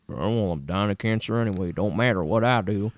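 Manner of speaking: quiet, shrugging put-on voice; offhand mother voice. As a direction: toss it off carelessly. Imitating mother shrugging off cancer.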